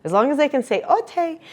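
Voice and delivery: put-on "ote" said high like a small child; toddler's okay. Shown as a demonstration. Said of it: "can just say the word okay".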